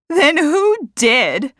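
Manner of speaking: high, loud, drawn-out challenge; demanding. A narrator's reading. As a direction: demand the culprit's name. Accuser demands a culprit.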